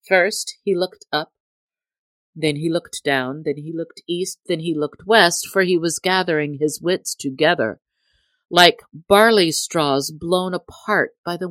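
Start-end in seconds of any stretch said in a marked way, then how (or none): none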